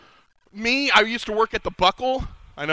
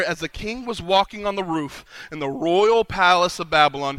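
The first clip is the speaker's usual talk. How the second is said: normal talking voice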